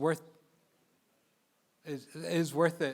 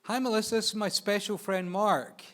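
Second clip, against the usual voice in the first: higher-pitched imitation of a star introducing a friend; retold introduction, raised voice; Bill Murray; 6 semitones higher, 4 dB louder than usual. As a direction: play a showy introduction, pitched up. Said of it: "and he's like".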